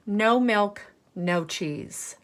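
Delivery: usual speaking voice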